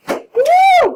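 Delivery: very high, loud whoop; excited cheer. Real, not acted. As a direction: cheer like a fan. Cheering at next topic.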